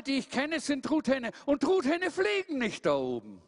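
hoch und nachdrücklich aufzählend; Truthahn, der geduldig den Bruder belehrt; belehrender Truthahn. Acted. Tom wiederholt Litanei hoch. (Hieronymus belehrt Tom, hoch und eindringlich)

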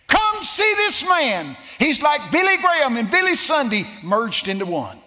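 shouting the front-page headline; hyped headline shout. Acted. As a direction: sell it like a carnival barker. Reading out headline with hype.

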